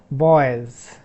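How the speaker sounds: Boys with the final s voiced as a buzzing z; Z sound in boys. Shown as a demonstration. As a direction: lean on the z at the end of Boys. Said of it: "if you say boys, this S".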